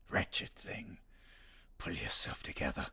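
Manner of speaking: lightly voiced, breathy creature line; a dark voice hissing a command; sinister character voice. Streamer performing her cameo line for chat, a hint of menace. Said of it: "Isn't it marvellous?".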